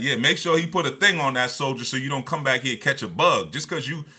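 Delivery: imitated grandfather. Grandfather warning the doctor about her date, loud imitated advice. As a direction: lay down the warning loudly as grandfather would. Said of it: "he was like".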